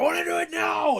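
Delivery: high shouted mock tantrum. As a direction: yell it like an enraged singer in the studio. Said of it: "Bruce was just pissed".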